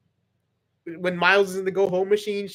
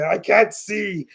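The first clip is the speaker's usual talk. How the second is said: slow villain voice; 3 semitones higher, much slower than usual. Pretend. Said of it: "Shredder would say".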